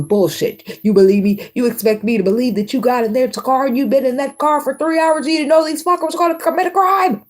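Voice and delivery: high, incredulous grilling; hammed-up cop not buying the story; mock detective. Detective grilling a suspect, mock incredulous. Mock interrogation, first forty-eight.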